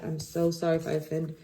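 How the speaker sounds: ordinary talk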